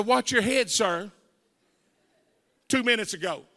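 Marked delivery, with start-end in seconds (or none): 0.0-1.1 louder, mocking echo of the cop; sarcastic mock cop line. Preacher mocking the cop's courtesy to the crowd, sarcastic. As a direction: throw the cop's words back, louder. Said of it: "Where was the".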